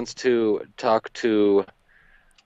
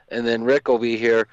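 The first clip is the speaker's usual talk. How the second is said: ordinary delivery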